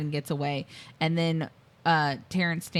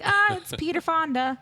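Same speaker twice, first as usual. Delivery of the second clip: mock delighted recognition; 10 semitones higher than usual. Retelling reaction to the montage.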